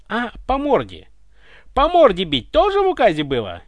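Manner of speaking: high, loud sarcastic question; caustic. A narrator's reading. Ivan asks about being hit, caustic. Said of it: "язвительно поинтересовался Иван".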